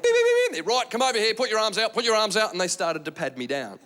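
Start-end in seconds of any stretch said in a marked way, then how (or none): 0.0-0.7 high imitated alarm beeps; shrill mimicked security alarm; metal detector. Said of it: "the metal detector's gone off". 0.7-2.5 lightly voiced officious guard; a hint of brisk airport security. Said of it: "the security guys go".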